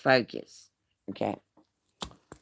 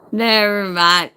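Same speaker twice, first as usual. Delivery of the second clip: louder, higher imitated backing-off; emphatic retold dismissal; 5 semitones higher, 7 dB louder than usual. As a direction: call it out higher, imitating someone giving up. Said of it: "They're gonna be like".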